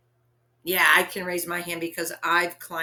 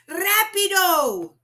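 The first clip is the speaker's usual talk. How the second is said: urgent shout; 11 semitones higher, 7 dB louder, slower than usual. Acted. Father urges hurry.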